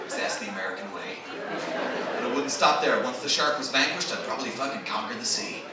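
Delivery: low, measured American self-help speaker; earnest American speechmaker. Retold or imitated. American audience member answers the comedian's question, earnest speechmaker. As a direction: imitate an American solemnly addressing the crowd, dropping low and soft. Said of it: "I asked an American guy once".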